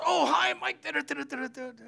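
high, excited imitation of a man calling out from a van; all excited. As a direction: gush it out like you're thrilled to see him. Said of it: "the guy next to us in a van goes".

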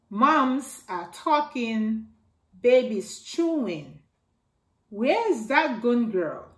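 ordinary delivery

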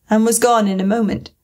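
ordinary delivery

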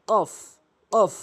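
কফ pushed out from the back of the tongue; pushed qaf. Shown as a demonstration. Teacher showing students how কফ is pushed out. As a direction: punch কফ from the tongue root against the palate. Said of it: "কফ ধাক্কা দিয়ে".

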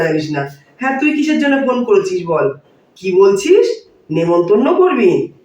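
ordinary talk

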